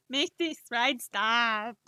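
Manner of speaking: loud, drawn-out mock plea; theatrical desperate shout; hammed-up wail. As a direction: wail it slowly, like begging off a fairground ride. Hammed-up plea to stop accent.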